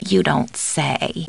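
low, soft, very slow; subdued take. Acted. Housekeeper hearing news from caller, subdued. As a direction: say it slowly and quietly, unimpressed. Alternate takes of stock line.

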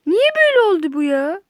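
loud frustrated wail; exasperated outcry. Real, not acted. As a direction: cry it out in frustration. Repeats complaint, second louder.

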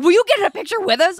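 high, quick, eager imitation; retold fan excitement. Retold thrilled fans asking for photo.

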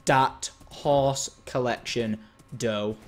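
very slow, word-by-word "That horse collection dough"; deliberate slow repeat. Pretend. Player admiring his horses, very slow deliberate repeat. Repeats phrase much slower.

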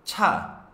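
"Cha" alto y fuerte; sílaba coreana. Shown as a demonstration. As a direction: lanza la sílaba "Cha" con fuerza. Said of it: "significa coche". (pronuncia "Cha" aguda y fuerte)

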